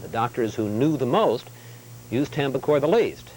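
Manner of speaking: measured, deliberate correspondent read; authoritative broadcast narration with falling phrase ends; news voice-over. Acted. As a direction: weigh each key word like a TV investigative report.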